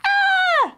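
very high alarmed shriek; high storybook wail; animals' scream. A narrator's reading. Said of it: "the animals cried".

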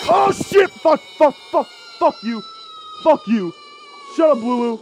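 shrieking curses, very high; a panicked scream when caught; jump-scare scream. Real, not acted. Player caught by the animatronic, panicked shriek.

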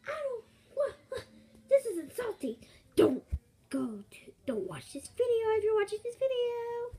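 high, loud mock outrage; hammed-up offended waitress wail; insulted waitress. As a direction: protest in an over-the-top high voice.

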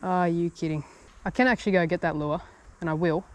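ordinary delivery

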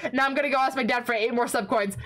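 ordinary delivery

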